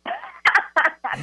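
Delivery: bright burst of laughter; high laugh. Real, not acted. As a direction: laugh out, delighted. Laughs at the card reading.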